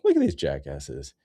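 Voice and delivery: ordinary talk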